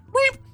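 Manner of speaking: very high tiny squeak; mock creature squeak. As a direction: squeak like a pop-out monster. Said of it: "be like".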